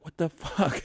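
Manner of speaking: high-pitched laughing outburst, put-on voice; mock disbelief. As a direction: toss it off as an exasperated, laughing roommate. Roommate annoyed by repeated album.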